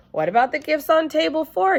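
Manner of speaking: high, loud, wide-eyed question; innocent child question. Acted. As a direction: ask like a kid who truly doesn't get it. Sheldon asking innocently.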